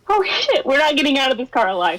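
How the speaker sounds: high, fast laughing cry; laughing panic. Real, not acted. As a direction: squeal it out while laughing.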